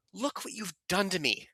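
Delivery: hushed, broken whisper; Giovanni whispering. Acted. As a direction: whisper it as if wounded. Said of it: "you're gonna be Giovanni".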